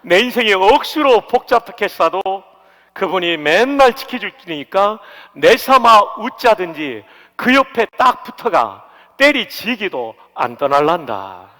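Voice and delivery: 가벼운 경상도 사투리; Gyeongsang dialect. Shown as a demonstration. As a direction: keep the mild Gyeongsang lilt. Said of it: "경상도 말이 익숙하지 않습니다".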